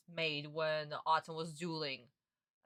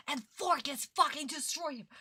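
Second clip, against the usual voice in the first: louder and higher, furious at the dub; outraged repeat; 7 semitones higher, 3 dB louder than usual. Real, not acted. Streamer venting at Forkis for ruining Yugi, furious. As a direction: hurl it out in real outrage.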